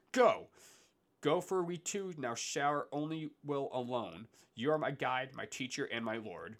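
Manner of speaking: crying out. Acted. Dante crying out his thanks to the lady, impassioned. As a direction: call out to her with a surge of courage. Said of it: "I cried out as a man".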